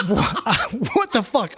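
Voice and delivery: high, loud laughing "what the fuck"; incredulous laugh at her getting fired; laughing disbelief. Retold or imitated. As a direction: burst out laughing in shock.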